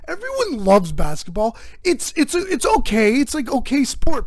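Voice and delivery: ordinary talk, nothing marked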